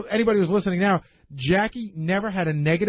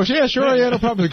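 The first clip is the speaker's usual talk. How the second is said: higher-pitched, easy yes to everyone; breezily agreeable; 6 semitones higher than usual. Retold or imitated. Host glad-handing people in public, breezily agreeable. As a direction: toss off a glib, people-pleasing promise. Acting out glib promise to people.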